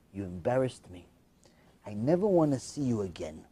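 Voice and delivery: low, soft, grave delivery; quiet rejection. Retold or imitated. Son disowning his mother after the party, quiet and low. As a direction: say it low and final.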